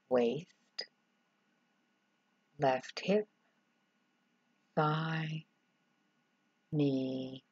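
normal talking voice

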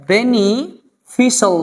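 "বেনিফিশিয়াল" slowly split as beni... fi-sal; syllable by syllable. Shown as a demonstration. Teacher answering what it will be, "বেনিফিশিয়াল" slowly in parts. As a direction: break "বেনিফিশিয়াল" after beni, drawing it out. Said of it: "কি হবে?".

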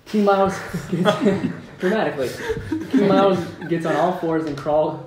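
loud giggling through the line; laughing while narrating. Real, not acted. Narrator cracking up reading the script, laughing. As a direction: crack up mid-sentence. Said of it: "narrator breaks up laughing".

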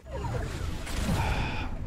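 long weary exhale; heavy sigh. Real, not acted. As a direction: let out a tired breathy sigh. Struggling in the game.

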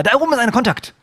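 high-pitched urgent callout; alarmed. Real, not acted. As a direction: blurt out an enemy sighting. Spots an enemy.

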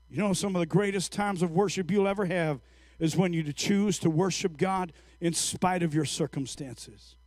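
ordinary delivery

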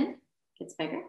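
breathy whisper. Shown as a demonstration. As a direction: breathe the words out softly. Breathing exercise, breath held.

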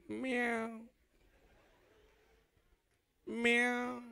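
soft, coy cat meow; girl's soft meow. Retold or imitated. The girl answers him with a soft cat meow. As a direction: purr it out like a coy kitten. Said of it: "Now the girl do".

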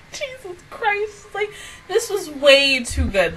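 slow, very soft, choked exclamation; squeezed out through a teary laugh; tearful. Real, not acted. Overwhelmed, crying after the episode.

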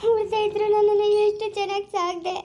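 tearful, wailing gratitude; crying farewell. Acted. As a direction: sob through a painful goodbye. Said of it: "ಅಳ್ಬಾರ್ದು, ಸುಮ್ನೆ ಇರು ಮಗಳೇ". (ಅಳುತ್ತಾ ನೋವಿನಿಂದ)

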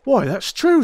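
a sudden cry of astonishment; startled. A narrator's reading. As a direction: caught off guard and amazed. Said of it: "Rance gave a violent jump and stared".

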